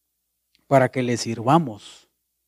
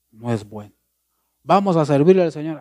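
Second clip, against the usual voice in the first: normal talking voice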